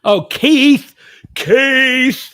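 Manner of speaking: high, loud, gleeful call of a name; big happy shout-out; delighted greeting. Real, not acted. Greets a familiar viewer by name.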